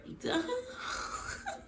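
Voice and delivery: high breathy laugh. Real, not acted. Laughing at scene.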